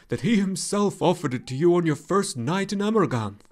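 the luck dragon scoffs grimly at the irony; grim laughing dragon. Acted. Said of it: "said Falkor with a grim laugh".

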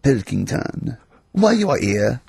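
lightly put-on French accent; comic French-accented emperor. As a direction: play Nero with a muddled French accent. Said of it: "I don't know why he's French".

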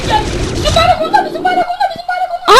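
shouting at full pitch, very loud; excited gamer yell. Real, not acted. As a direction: yell it out to your teammate, high and loud. Yelling that he has the flag.

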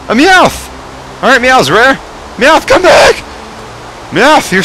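very high excited squeal; thrilled squeal. Real, not acted. Gamer squealing at a rare Meowth. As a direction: shriek with delight.

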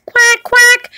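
very high squeaky quack; duck quack. Acted. Duck introduced as the neutral party, very high quack.

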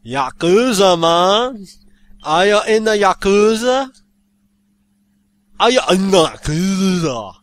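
high, loud, drawn-out mock Jamaican accent; badly faked Jamaican accent, called out. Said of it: "a Jamaican could come up and say".

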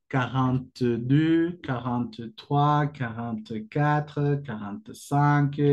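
contar despacio, cada número claro; slow counting. Shown as a demonstration. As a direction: cuenta quarante-deux a quarante-cinq con calma. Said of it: "counting demo". (conteo lento de profesor)

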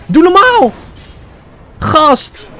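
real startled yell, high and loud; high-pitched outburst of gamer shock; shocked shout. Real, not acted. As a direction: shout in alarm at the attack. Shocked by sudden in-game attack.

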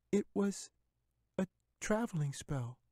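put-on high, polite girl's voice; timid little girl. A narrator's reading. Katy answering the bear, high and timid.

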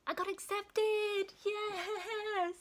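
high, slow, delighted cheer; real joy. Real, not acted. Student nurse announcing her acceptance, high delighted cheer. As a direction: burst out with thrilled triumph.

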